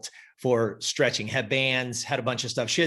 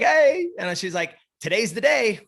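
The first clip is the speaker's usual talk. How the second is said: higher, bright announcement; excited client; 8 semitones higher, 4 dB louder than usual. Retold or imitated. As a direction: play her excitement, pitched up.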